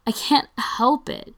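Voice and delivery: high, loud, drawn-out delight; so happy. A narrator's reading. Brendan excuses kissing Spencer to Ryan, happily. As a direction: lift it into a gleeful, slow singsong. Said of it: "Brendan sounds so happy".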